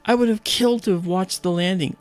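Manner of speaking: high, forceful gushing; excited envy. Retold or imitated. Space fan envying friend at the landing, excited.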